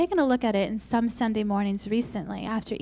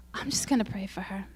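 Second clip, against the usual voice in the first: whispered, softer, giving in; resigned whisper; 2 semitones lower, 6 dB quieter, faster than usual. Retold or imitated. After the long run, a whispered surrender. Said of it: "I thought".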